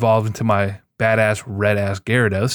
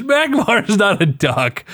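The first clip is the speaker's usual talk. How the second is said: higher, drawn-out protest; indignant correction; 8 semitones higher, slower than usual. Real, not acted. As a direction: set him straight, incredulous.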